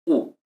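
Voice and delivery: "u" said as one plain u vowel; just one clean "u"; single u sound. Shown as a demonstration. Teacher giving the right reading of the diphthong, a single "u". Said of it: "sino que se pronuncia u".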